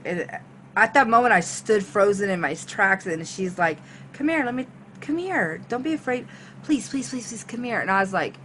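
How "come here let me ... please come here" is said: higher, pleading come-on; coaxing plea. Retold or imitated. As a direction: imitate a woman coaxing someone closer. Retold woman coaxing her closer.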